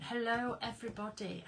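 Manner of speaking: ordinary talk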